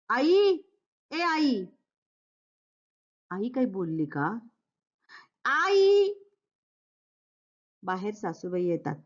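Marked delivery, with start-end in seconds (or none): 0.1-1.6 high shout of आई; hungry son yelling for his mother; calling out to mother. Acted. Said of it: "केवढ्यांदा ओरडतोयस?". 5.4-6.1 high shout of आई; hungry son yelling for his mother; calling out to mother. Acted. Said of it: "केवढ्यांदा ओरडतोयस?".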